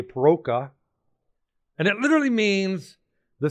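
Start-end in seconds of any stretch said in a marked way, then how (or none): 0.0-0.7 paroka said with a hard K in the middle; hard K version. Shown as a demonstration. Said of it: "Some use a hard K".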